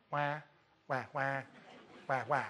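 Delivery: soft mock baby wail; wah-wah like a sulking kid, quiet; mock crying. Mocking Elijah's self-pity.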